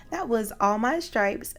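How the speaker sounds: ordinary delivery, nothing marked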